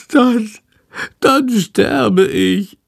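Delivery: high, slow, dramatic creature wail; whining. A narrator's reading. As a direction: draw it out as a dying lament. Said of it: "jammert Shandor". (jammernd, hoch und langgezogen)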